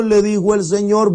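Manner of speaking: plain reading voice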